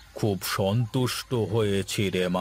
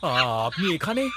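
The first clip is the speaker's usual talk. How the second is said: startled stutter, pitched up; stammering surprise; 5 semitones higher than usual. Acted. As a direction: caught off guard, stumble over it. Sujoy stammers, caught out. (চমকে তোতলানো)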